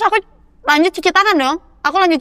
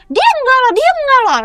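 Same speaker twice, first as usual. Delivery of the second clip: much higher insistent protest; a hint of a pleading girl, pitched up; imitating girl's protest; 12 semitones higher than usual. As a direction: play the friend pleading that she didn't. Girl defends friend during fight.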